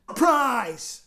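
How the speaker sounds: calling out. Acted. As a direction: blurt it out across the class. Henry calling out again.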